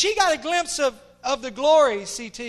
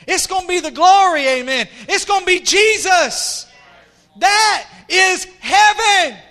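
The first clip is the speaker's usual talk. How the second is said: loud, emphatic pulpit proclamation; fervent preaching; 6 semitones higher, 8 dB louder than usual. Real, not acted. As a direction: preach it at full force, building to a shout. Preaches at full force.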